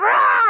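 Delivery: loud, high mock growl "Rah!". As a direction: growl it out at the kid like a frazzled mom. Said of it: "and I growled".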